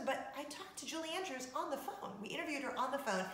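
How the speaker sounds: normal talking voice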